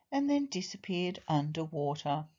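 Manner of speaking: ordinary delivery, nothing marked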